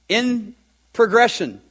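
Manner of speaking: ordinary talk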